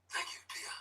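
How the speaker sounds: hushed golf announcer whisper; golf commentator. Shown as a demonstration. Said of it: "talking with my golf voice".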